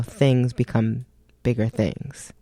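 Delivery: ordinary delivery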